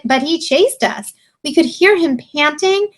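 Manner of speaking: high, slow, dramatic sheep voice; bawling sheep. A narrator's reading. Sheep telling Little Bear about the wolf, high bawl. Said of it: "bawled the sheep".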